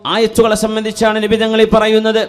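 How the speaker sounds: high, projected sermon voice; impassioned preaching. Real, not acted. Preacher explaining which verses the Prophet means, impassioned. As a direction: announce it ringingly to the congregation. Preaches at full force to congregation.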